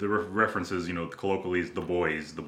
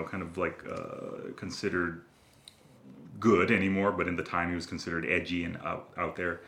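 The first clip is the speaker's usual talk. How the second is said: ordinary talk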